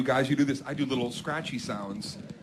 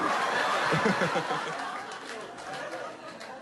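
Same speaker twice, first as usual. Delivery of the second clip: big laugh; 3 semitones higher, 4 dB quieter than usual. Real, not acted. Laughing after the bit.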